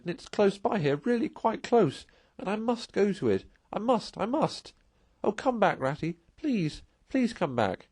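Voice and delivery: high, desperate pleading, slowing at the end; pleading Mole. Acted. Mole begging Rat to turn back, high and pleading. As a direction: beg him, near tears, to come back. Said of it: "pleaded the poor Mole in anguish".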